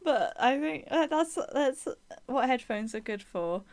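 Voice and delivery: ordinary talk, nothing marked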